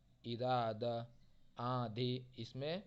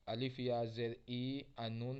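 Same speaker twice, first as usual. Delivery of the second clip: slow letter-by-letter spelling of ई; slower than usual. Shown as a demonstration. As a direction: spell it out for students. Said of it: "इस तरीके से हमें पढ़ना है". (धीमे हिज्जे)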